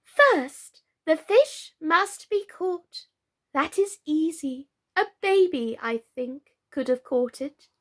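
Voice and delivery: high, sing-song royal recital; riddle recitation. A narrator's reading.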